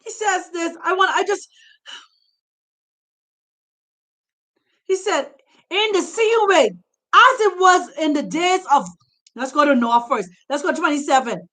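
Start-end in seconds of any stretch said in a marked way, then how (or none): 0.0-8.9 rising, shouting sermon delivery; preaching. Acted. Preacher urging Bantus to leave, fiery and shouting. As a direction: preach it hard to the congregation. Preacher exhorting congregation at full force.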